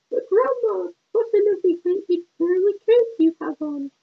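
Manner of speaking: put-on high, wide-eyed little girl. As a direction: squeak it like an innocent child. Girl questions disguised wolf.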